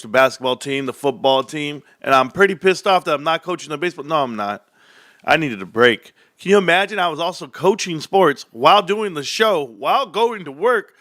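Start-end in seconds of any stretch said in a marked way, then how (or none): none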